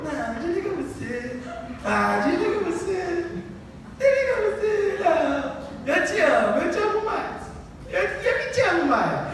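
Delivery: high, giggling lovey-dovey voice; giggly late night call. Retold or imitated. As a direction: squeal it like a smitten teen. Lovers' phone call at night.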